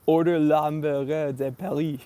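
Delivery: hammed-up French waiter voice; ordering in a silly fake French accent; mock French accent.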